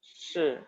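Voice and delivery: Shi with the tongue curled back; retroflex shi. Shown as a demonstration. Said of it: "lidahnya agak ditekuk".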